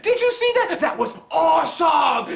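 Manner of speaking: put-on high, loud kid gushing; excited kid. Kid raving after watching Zeus fight, excited shout. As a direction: imitate a thrilled kid shouting with delight. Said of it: "He's like".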